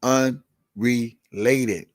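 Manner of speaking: unrelated, stretched very slow, every syllable held; drawn out slowly. Shown as a demonstration. Streamer repeating the key word to his viewers, very slowly. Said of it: "Let me say it again".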